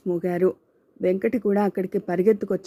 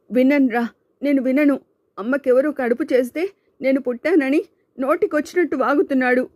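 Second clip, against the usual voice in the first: tearful furious shout; 6 semitones higher than usual. Acted. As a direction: shout him down in grief and anger. Shanku tearful, raging at Ravi. (కన్నీళ్లతో ఆవేశంగా అరుపు)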